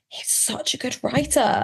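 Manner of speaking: high, drawn-out awed exclamation; admiring outburst. Retold or imitated. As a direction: gush it slowly in mock-overwhelmed admiration. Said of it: "I just stopped and went".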